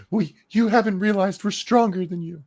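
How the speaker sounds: high-pitched mock of her boast; mocking female voice. Host parroting the woman's claim of strength, high mocking voice.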